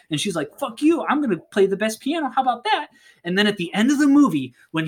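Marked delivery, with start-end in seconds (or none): 0.6-2.9 high, rapid mock defiance; acted heated one-upmanship; competitive comeback. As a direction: top a rival's boast, fast and high. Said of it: "they're competing with each other".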